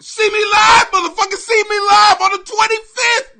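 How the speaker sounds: usual speaking voice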